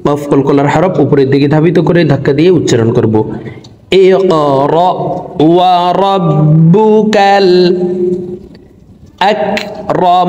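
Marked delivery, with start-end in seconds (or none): 4.1-10.3 high, slow melodic chant; recitation. Shown as a demonstration. Teacher reciting the second verse, high slow chant. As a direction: intone it slowly, held high.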